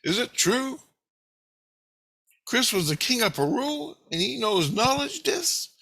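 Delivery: high-pitched, incredulous questioning; imitated Julie. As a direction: ask it high, rising in wonder. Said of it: "then Julie asked Grace".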